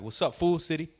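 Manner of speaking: loud, high street-style greeting. Retold or imitated. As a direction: call it out like a homie.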